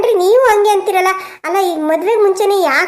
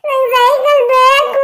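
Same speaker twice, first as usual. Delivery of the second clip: put-on higher, slow wailing little boy; crying child; 9 semitones higher, 4 dB louder, slower than usual. Grandson wailing at father for a bicycle, tearful. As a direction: wail the demand through tears.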